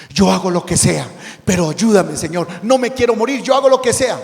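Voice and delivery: a terrified man promising anything; pleading. Acted. Climber begging God.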